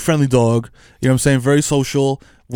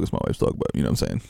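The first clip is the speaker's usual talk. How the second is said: low, soft half-whisper; whispered aside; 10 dB quieter than usual. Real, not acted. Admitting it's his wife's dog, whispered aside. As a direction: drop to a secret aside.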